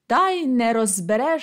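plain reading voice

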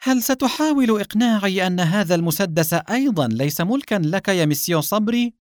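high-pitched, mocking question; smug insinuation; sly. A narrator's reading. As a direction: needle the suspect slyly, voice lifted. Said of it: "ابتسم الرجل بخبث وقال".

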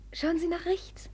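soft unvoiced whisper; hushed aside. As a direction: whisper a secret pointer. Secret aside to the prince.

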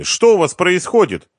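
high, loud, indignant wife; agitated woman shouting to be let in; wife's voice. A narrator's reading. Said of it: "раздался голос жены".

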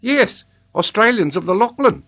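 put-on high, loud child with accent; Tolini. A narrator's reading. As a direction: pipe up as an accented little boy. Child answers in character voice.

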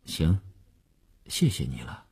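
low, soft, dejected thanks; glum. A narrator's reading. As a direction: mumble thanks without any joy. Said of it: "他闷闷地说".

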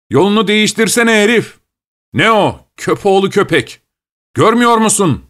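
high-pitched angry yelling, without much added volume; angry street yells. A narrator's reading. Voices in the dark cursing the cabman, angry. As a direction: bawl out a careless driver. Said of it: "sesleri işitilir".

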